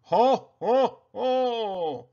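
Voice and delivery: high, loud jolly Santa laugh; belly-laugh it out like Santa. Acted. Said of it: "Santa laughing".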